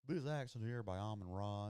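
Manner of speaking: a light Southern accent put on; imitating Southern accent. Streamer mimicking the player's Southern way of talking, light Southern accent. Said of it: "if you could talk like that".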